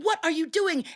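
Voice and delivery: alarmed Brahmin. Acted. Brahmin pinned under the tiger.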